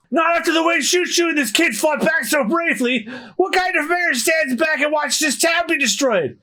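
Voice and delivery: high-pitched, outraged shouting; fired-up mayor. Acted. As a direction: roar against the bullies at full pitch. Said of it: "Mayor Boodle is fired up".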